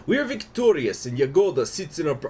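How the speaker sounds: put-on high, loud Russian-accented declaration. Event text read as Sablin, loud Russian accent. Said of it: "I only do the accent for the events".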